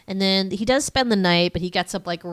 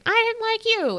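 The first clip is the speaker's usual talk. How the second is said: very high bickering comeback; wife's retort. Retold or imitated. Recapping the couple's interview, her high mock retort. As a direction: mimic the wife answering back, pitched way up. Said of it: "and they're like".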